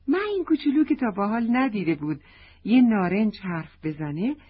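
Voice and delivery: ordinary delivery, nothing marked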